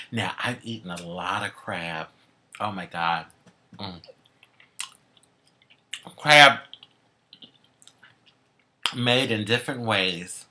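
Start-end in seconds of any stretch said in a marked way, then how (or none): none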